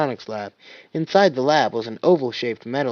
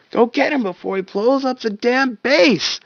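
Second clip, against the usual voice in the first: higher, louder panicked yell; panicked shout; 11 semitones higher, 5 dB louder than usual. Acted. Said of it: "Tucker shouted, panic rising".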